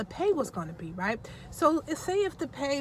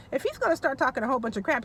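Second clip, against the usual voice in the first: ordinary delivery, nothing marked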